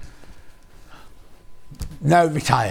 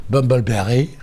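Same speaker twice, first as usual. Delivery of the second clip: Bumbleberry in a lower put-on accent; funny accent; 4 semitones lower than usual. Shown as a demonstration. Host repeats fabric name for viewers in a silly accent. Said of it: "Don't know why I did it in that accent".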